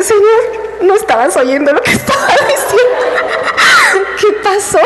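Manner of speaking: pregunta aguda entre risas reales, lenta; riéndose sin control. Real, not acted. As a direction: hablarle a Dios casi sin poder contener la risa. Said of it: "ya necesitaba reírme porque no me podía controlar". (reclamándole a Dios entre risas, más agudo)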